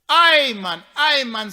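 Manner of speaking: high, very loud exclamation; shouted sympathy. Acted. Friend below reacts to the news, shouting up. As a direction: cry it up to a far-off friend. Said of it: "skree ek van".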